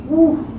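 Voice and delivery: a high shaky breath out before going on; emotional exhale. Real, not acted. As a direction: let the feeling out in one breath. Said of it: "I get emotional thinking about this".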